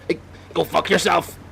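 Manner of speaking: high, mock-tough Northern Irish yell, badly overdone; hammed-up Northern Irish accent. Swearing at the townsfolk for fun, hammed-up Northern Irish accent. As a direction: throw the line out in an exaggerated Northern Irish voice. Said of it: "a bad impression of, uh, his own accent".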